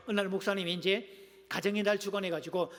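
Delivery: ordinary talk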